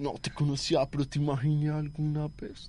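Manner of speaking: frase de telenovela, suave y melosa en broma; recítalo como galán cursi, bajito; mock romantic line. Mocking a dramatic romantic line. (cita romántica burlona, mucho más suave)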